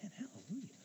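breathy whispered hallelujah; whispered praise. Real, not acted. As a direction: whisper it in awe. Hushed aside after point.

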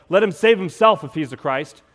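loud, jeering shout; jeering crowd shouts at Jesus on the cross; mocking yell. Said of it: "Some are yelling".

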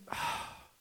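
soft, breathy, hopeless voice; despairing sigh. Pretend. Preacher voicing a hopeless listener, sighing quietly. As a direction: sigh it out like you've given up.

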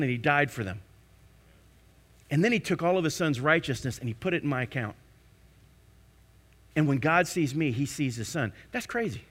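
ordinary talk

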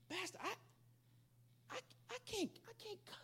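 high, soft, stammering acted reluctance; hesitant balking. Kid tells his pastor he can't cuss, acted hesitant balking. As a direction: balk in a small high voice, halting.

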